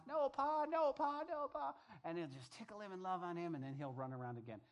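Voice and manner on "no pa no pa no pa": high-pitched little boy, lightly played; giggly grandson. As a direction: squeal it like a tickled toddler. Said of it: "he'll just laugh and laugh".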